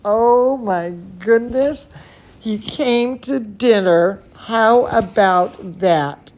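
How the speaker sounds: plain reading voice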